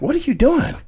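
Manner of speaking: very high, incredulous retold question; squeaky mock-startled protest. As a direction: pitch it way up in disbelief. Retells protesting at friend's advances.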